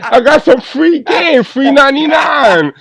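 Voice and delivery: laughing high and loud; giggling through the words; real laughter pitched high. Real, not acted. Laughing about free game.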